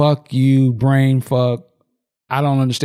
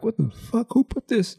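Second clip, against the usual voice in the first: high-pitched but soft mock yell; a held-back imitation of screaming for someone; retold screaming out; 10 semitones higher, 6 dB quieter than usual. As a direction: call out high without real volume. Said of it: "they're screaming out for somebody".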